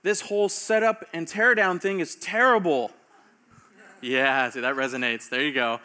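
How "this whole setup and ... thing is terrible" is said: higher, louder mock complaint; grumbling Israelites. Preacher voicing Israelites complaining about setup, mock whining. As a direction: whine it like a fed-up camper. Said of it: "They're like".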